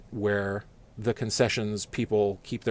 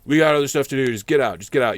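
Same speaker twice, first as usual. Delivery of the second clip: louder, impatient mock order; brusque cops; 3 semitones higher, 8 dB louder than usual. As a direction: wave him off like busy cops.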